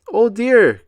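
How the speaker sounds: loud high oh dear; startled gasp. A narrator's reading. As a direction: gasp in sudden alarm. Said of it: "gasped Grandpa Pig".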